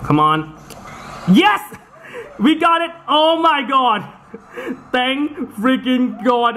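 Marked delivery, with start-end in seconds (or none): 1.3-6.6 high, jubilant shout with laughter; elated cheer. Real, not acted. Player who just got it, cheering in elation. Player scores after a tense attempt.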